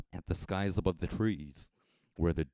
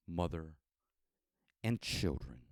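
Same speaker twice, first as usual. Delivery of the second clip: ordinary delivery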